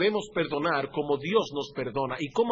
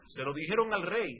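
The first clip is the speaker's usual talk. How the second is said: usual speaking voice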